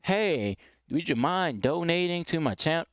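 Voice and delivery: put-on, overly tentative politeness; weak, passive asking. Shown as a demonstration. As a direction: imitate someone meekly asking for donations. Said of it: "you can't talk like that".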